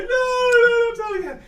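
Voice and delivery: high, loud crying scream; crying plea. Acted. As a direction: sob and shout the plea. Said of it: "they're like".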